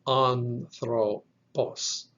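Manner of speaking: "anthropos" very slow, broken into an, thro, pos; pronunciation demo. Shown as a demonstration. Teacher repeats the Greek word for learners, very slow syllable by syllable. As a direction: draw out "anthropos" with pauses between syllables.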